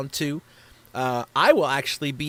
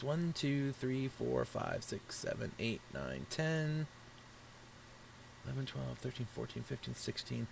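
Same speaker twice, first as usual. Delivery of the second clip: much softer, muttered count to himself; counting under breath; 13 dB quieter than usual. Real, not acted. Streamer counting sign-ups to himself, much softer. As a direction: count quietly as if checking a list alone.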